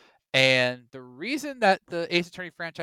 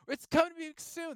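high sing-song leaker; mocking rumor voice; 14 semitones higher than usual. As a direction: mimic a smug leaker. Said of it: "Oh, direct's gonna be coming up soon".

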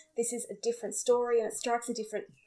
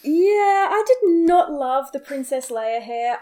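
normal talking voice

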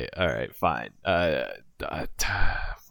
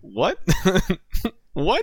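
startled mock reaction; 12 semitones higher than usual. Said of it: "turn around and be like".

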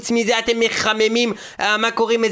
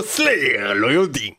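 deep dramatic voice; 10 semitones lower than usual. Acted. Mock dramatic low voice.

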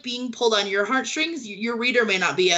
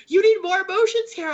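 higher and louder, pushy; mock demanding editor; 9 semitones higher, 4 dB louder than usual. Editor demanding more emotion from Carol, mock-pushy. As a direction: lightly play a nagging editor. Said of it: "my editor will be like".

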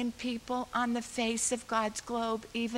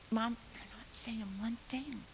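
put-on child's whisper; 7 dB quieter than usual. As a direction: whisper it like a small girl. Child whispering beside mother.

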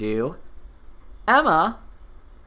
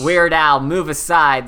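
mock proclamation; 3 semitones higher, 7 dB louder than usual.

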